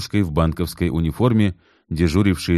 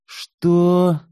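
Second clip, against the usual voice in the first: outraged; 12 semitones higher than usual. A narrator's reading. Kit outraged.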